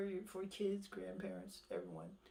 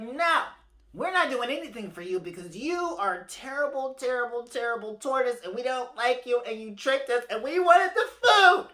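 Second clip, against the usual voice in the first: loud, higher scolding refusal; rising into a loud indignant squawk; angry birds; 5 semitones higher, 10 dB louder than usual. Acted. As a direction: tell him off as a flock. Birds angrily refuse the tortoise.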